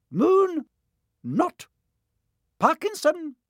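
high, loud roll call of names. A narrator's reading. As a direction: read each name to the hall. McGonagall calling names.

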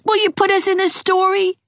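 put-on high, eager boy pressing; a child insisting on a story; high, pushy little boy; demanding. A narrator's reading. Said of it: "demanded Gerald".

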